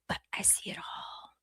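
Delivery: breathy ominous whisper. Acted. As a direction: whisper it like a secret. Ominous aside, then repeated aloud.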